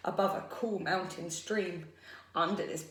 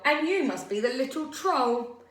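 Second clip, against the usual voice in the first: loud, higher-pitched big goat scolding; thundering; 6 semitones higher, 5 dB louder than usual. A narrator's reading. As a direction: thunder it at the little troll. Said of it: "he thundered".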